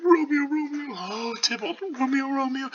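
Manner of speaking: squeaky high pretend weeping; hammed-up lovesick wailing; mock blubbering. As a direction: whimper the names in a very high, put-on voice. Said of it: "she's blubbering and weeping".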